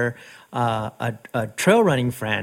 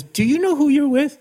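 much higher, insistent question; pointed challenge; 13 semitones higher than usual. Retold or imitated. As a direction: imitate a square-in-the-eye challenge, short of bullying. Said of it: "not bullying her, but he looked at her square in the eye".

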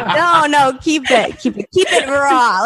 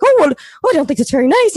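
Katie; 4 semitones higher, faster than usual. Retold or imitated. Comic retelling her friend's complaint, mock hurt protest. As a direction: object in a hammed-up high voice. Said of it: "And she's like".

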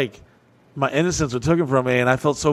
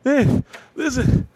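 high, shaky, breathless alarm; panicked kid; 10 semitones higher than usual. Retold or imitated. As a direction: tell it like a scared boy bursting in. Panicked kid telling stepdad.

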